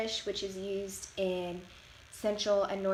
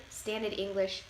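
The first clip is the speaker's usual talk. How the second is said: ordinary talk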